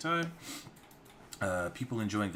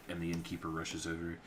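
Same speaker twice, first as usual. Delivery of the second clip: ordinary talk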